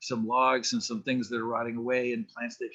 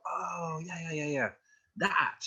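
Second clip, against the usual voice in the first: nostalgic adult; 7 semitones higher than usual. Retold or imitated. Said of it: "get people to go".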